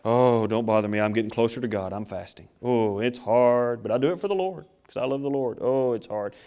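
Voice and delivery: low, soft, put-on suffering moan; miserable, pathetic Pharisee. Fasting Pharisee brushing off a concerned onlooker, mock misery. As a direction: play the pitiful martyr, voice sunk low. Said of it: "They'd walk around looking miserable".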